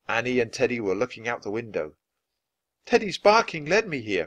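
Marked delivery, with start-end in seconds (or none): 2.8-4.3 high, excited call; crying out. A narrator's reading. Annie to Jack from the treehouse, crying out. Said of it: "cried Annie".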